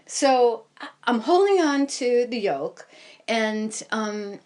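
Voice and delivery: ordinary talk, nothing marked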